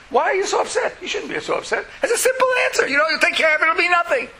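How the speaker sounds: high, breezy dismissive voice; belittling meta message. Pretend. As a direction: wave off their pain as trivial. Voicing the crushing meta message.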